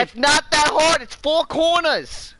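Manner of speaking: high, strained mock Italian voice; bad Italian accent. Mocking his partner's directions in a high, hammed-up Italian accent. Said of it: "It's the worst accent ever".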